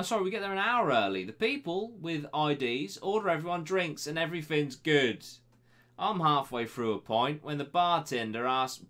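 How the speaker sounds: usual speaking voice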